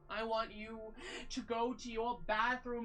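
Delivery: ordinary talk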